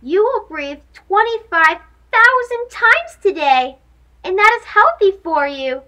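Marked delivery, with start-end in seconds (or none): none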